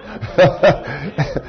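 hearty chuckle after own joke; amused laughter; laughing. Real, not acted. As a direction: laugh it off briefly. Joking about beating his son.

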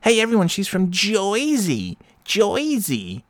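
high, slow mocking drawl on Jersey; mocking yokel. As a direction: ham up a taunting local yelling Jersey. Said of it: "And she's like".

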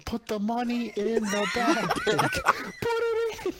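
slow, high cartoon voice; SpongeBob quote. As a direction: ham up the cartoon line. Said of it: "I imagine that SpongeBob scene".

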